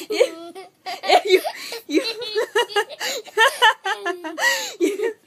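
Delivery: laughing through words, squealing; giggling while tickled; being tickled. Real, not acted. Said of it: "I'm ticklish there".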